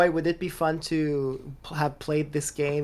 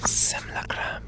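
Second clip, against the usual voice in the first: sultry whisper. Pretend. Mock sexy lingerie ad voice.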